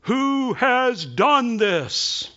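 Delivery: high, loud, very slow call from the wall; soldier's cry. Acted. Soldier on the wall crying out to the messengers, high and loud. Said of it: "a soldier looks down and cries out".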